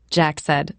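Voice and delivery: ordinary delivery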